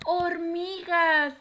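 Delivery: high, loud, drawn-out "Hormiga"; joined-up, triumphant "Hormiga"; cheering the answer. Shown as a demonstration. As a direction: celebrate the right answer with the children.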